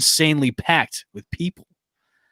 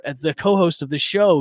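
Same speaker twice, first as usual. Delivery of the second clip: ordinary talk, nothing marked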